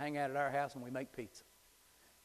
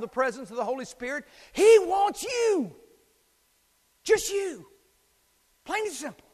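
preaching at full force; 10 semitones higher, 12 dB louder than usual. Acted. Pastor urging his congregation, preaching at full force. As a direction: pound it out like a revival preacher.

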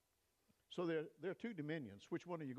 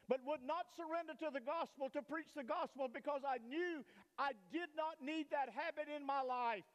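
high, ringing pulpit preaching that keeps climbing; impassioned gospel preacher in full flight; full-force preaching; 10 semitones higher than usual. Acted. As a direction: preach it out with fire to the back pews.

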